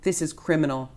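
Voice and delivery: ordinary delivery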